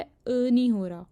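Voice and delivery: ordinary delivery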